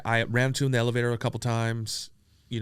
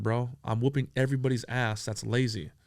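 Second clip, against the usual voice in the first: ordinary talk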